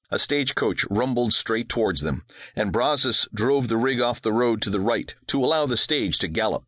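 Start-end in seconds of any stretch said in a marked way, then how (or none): none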